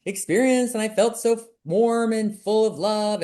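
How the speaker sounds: high, airy gushing about a lovely experience; mock-blissful temple testimony; gushing mock rapture. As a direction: mimic people raving, sugary and dreamy. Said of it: "they're like".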